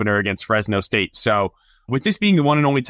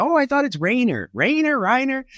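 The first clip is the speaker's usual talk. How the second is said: much higher, puzzled mock voice; confused colleague; 13 semitones higher than usual. Colleague in the circle puzzling over the name, high mock voice. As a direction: play a baffled colleague mixing up the name, pitched way up. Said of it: "Someone says".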